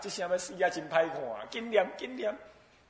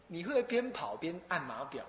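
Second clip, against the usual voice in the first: ordinary delivery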